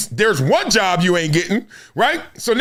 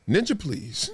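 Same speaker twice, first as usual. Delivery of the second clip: 1 dismissive; 8 dB quieter than usual. Retold or imitated. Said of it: "looked at them like".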